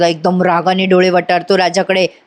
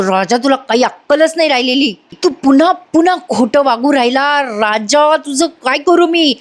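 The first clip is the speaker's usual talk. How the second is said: raised-pitch, exasperated scolding; acted exasperation; 5 semitones higher than usual. Yasha fed up with Raja's lying, exasperated scolding. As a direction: scold him, pitch climbing in frustration. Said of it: "Yashada खूप चिडतो बरं का आणि म्हणतो".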